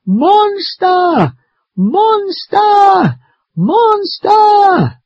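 very high, long full-voiced screams; very loud yell. Maddie in the tree screams for grandfather, very loud yell. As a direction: yell each word at the top of your range. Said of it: "I yell very loudly".

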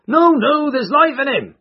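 high, loud shout from a bystander. A narrator's reading. Bystander contradicts the crowd about the injured man, shouting. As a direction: shout the protest out over the crowd. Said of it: "shouted another".

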